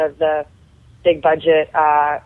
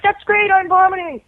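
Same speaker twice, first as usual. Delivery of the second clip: put-on much higher, strained sick-girl voice; mock whiny sarcasm; 11 semitones higher than usual. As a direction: squeak it out like a queasy kid. Sick actor's retold sarcastic reply.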